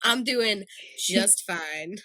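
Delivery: ordinary talk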